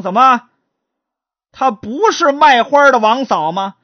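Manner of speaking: loud, high startled question; stunned husband demands an answer; shocked disbelief. Acted. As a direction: blurt it out in shock.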